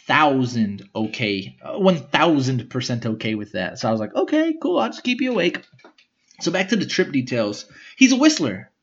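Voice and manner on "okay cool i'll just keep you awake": high mock cheerful. Mock cheerful reply to brother.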